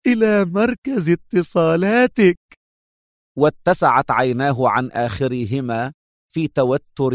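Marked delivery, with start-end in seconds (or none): 0.1-2.4 high, drawn-out triumphant taunt; gloating mockery. A narrator's reading. Captive woman leaning in with the final reveal, gloating. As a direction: savour the reveal slowly in a high voice. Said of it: "مستطردة في شماتة ساخرة متهكمة".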